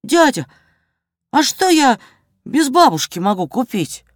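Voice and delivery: высокий детский голос, с паузами; спросить по-мальчишески, начиная высоко; мальчик-проситель. A narrator's reading. Мальчик обращается к продавцу. (по-детски, повыше)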